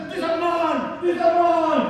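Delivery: high, loud mock-villain grabbing; greedy Grinch shout. Grinch grabbing presents from the tree, greedy mock shout. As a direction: shout your claim like a playful thief.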